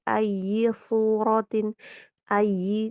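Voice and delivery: "ayyi" pressed at once, slow and loud. Shown as a demonstration. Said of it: "jangan ditahan ya, tapi langsung ditekan". ("ayyi" langsung ditekan)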